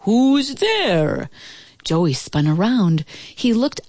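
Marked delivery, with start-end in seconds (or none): 0.1-1.3 booming voice. A narrator's reading. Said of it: "a voice boomed out".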